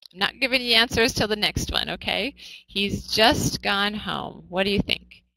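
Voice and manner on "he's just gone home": slow, careful reading, every word spaced; slow example read. Shown as a demonstration. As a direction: read it out slowly for the class. Teacher repeats example sentence slowly.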